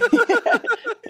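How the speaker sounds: real laugh shooting way up high; cracking up at the elevator joke; high shrieking laugh. Real, not acted. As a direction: let out a squealing cackle.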